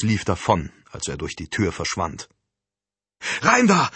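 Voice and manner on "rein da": high, loud urgent order; shouted command. A narrator's reading. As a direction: shout it like someone taking command. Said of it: "schrie Alicia".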